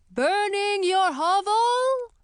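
very high, slow squeak; squeaky helpful witch. Acted. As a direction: chirp it out helpfully. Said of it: "Chlorine said helpfully".

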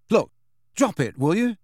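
high, exasperated outburst; bursting out. A narrator's reading. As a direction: snap it out, fed up. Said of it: "Harry burst out".

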